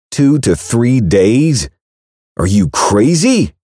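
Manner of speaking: slow, incredulous and indignant; can't believe what he hears; outraged disbelief. Acted. Braxton to Kat, outraged disbelief.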